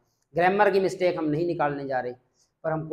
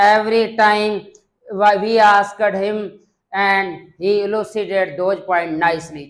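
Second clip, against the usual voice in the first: slow, louder, carefully paced English; model speech demo; 4 semitones higher, 7 dB louder, much slower than usual. Shown as a demonstration. Teacher models an English answer for students, slow formal delivery. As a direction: read it out as the model answer for the class.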